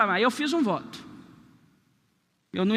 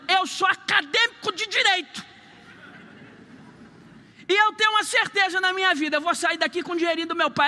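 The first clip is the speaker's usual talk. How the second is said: higher-pitched, pushy lecturing of the clerk; indignant outburst; 6 semitones higher than usual. Retold or imitated. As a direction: put the teller in her place, pitch raised. Banged the table, confronting teller.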